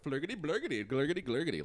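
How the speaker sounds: high, loud mock Swedish babble; high loud fake Swedish gibberish. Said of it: "they're like".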